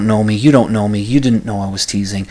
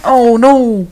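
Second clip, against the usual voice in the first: a sudden high, loud "oh no" of mock alarm; hammed-up horrified oh no; mock dismay; 12 semitones higher, 6 dB louder than usual. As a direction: yelp it like you've been caught out. Mock reaction to being told off.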